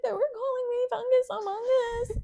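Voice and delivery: high, teary imitated sob-talk; hammed-up tearful complaint; mock crying. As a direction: whimper it like a crying kid. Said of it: "And she's like".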